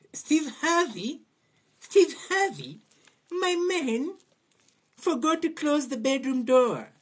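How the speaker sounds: sing-song high mockery; mocking gossip about a celebrity, high falsetto. Mock women on Steve Harvey.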